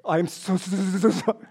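stammering "so" like a cool guy who can't say sorry; Fonzie stuck on sorry. Retold or imitated. Pastor imitating Fonzie failing to apologize, mild stammer. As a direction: keep stalling on "so". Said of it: "he'd go up to somebody and say".

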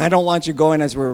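ordinary delivery

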